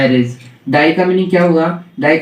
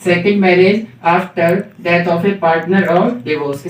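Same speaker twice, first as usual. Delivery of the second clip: slow dictation; much slower than usual. Shown as a demonstration. Teacher dictating a definition.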